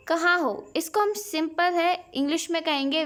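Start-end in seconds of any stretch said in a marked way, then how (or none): none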